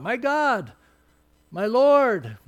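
fervent loud cry to the Lord. Retold or imitated. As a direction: cry it out in awe, voice rising. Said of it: "Thomas cries out".

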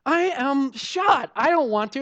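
loud, drawn-out mock complaint; worn-out refusal, played up; exhausted protest. Acting out tired refusal to cook.

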